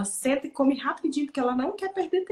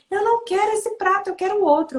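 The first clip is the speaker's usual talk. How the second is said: put-on high, loud little-kid protest; upset child; 7 semitones higher, 4 dB louder than usual. Mother mimics her son refusing the plate, high and loud. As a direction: imitate a child refusing, higher and insistent. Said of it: "Ele não queria comer naquele prato".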